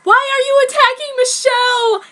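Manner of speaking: very high, loud, distressed cry; alarmed shout. Acted. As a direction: burst in to stop the fight. Teacher bursting in crying.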